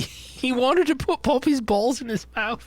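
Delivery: high, hammed-up hysterical accusation; off the rails. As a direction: imitate a woman spiralling, pitch climbing high. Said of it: "she goes raw off the rails".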